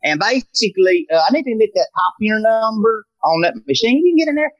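high, chirpy cold-call salesman voice; telemarketer voice. Retold or imitated. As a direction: sweet-talk the receptionist like a pushy phone seller. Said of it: "doing a lot of different accents".

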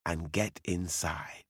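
urgent hushed whisper. Acted. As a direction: whisper it close and low. Urging mother into pumpkin.

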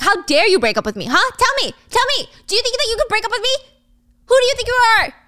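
high, frantic, escalating tirade; enraged demands. Acted. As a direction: build to a high, outraged yell. Acted boyfriend raging at the breakup.

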